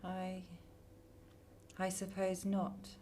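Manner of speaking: barely audible, dazed agreement; hushed, reluctant giving in. Acted. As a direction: yield quietly to the voice. Entranced girl answering sinister voice.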